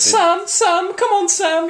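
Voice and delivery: high-pitched mock call; squeaky imitation calling Sam. Mimicking Lethal Weapon scene.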